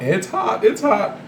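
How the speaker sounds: real high-pitched heat warning; hot to touch. Real, not acted. Cook tearing open a hot roll, high-pitched reaction.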